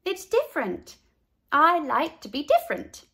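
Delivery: lightly voiced proud girl. A narrator's reading. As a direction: stand firm for being different. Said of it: "said Carla".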